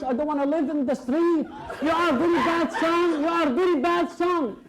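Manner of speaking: put-on high scolding old woman voice; old woman shouting. Old woman scolding supposed son, high shrill scolding. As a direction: berate him like a wronged mother. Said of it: "she shouted again".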